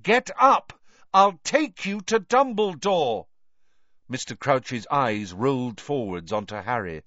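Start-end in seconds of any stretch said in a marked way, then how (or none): 0.0-3.2 high, urgent shout; raised, distinct, pressing voice; loud and clear. A narrator's reading. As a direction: rouse him with a clear, loud promise. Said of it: "said Harry loudly and clearly".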